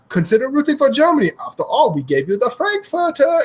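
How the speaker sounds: high-pitched mock German accent. German pitchman courting fans in a commercial, mock German accent. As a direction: sell it in a hammed-up German accent. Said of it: "the Germany one just does exactly".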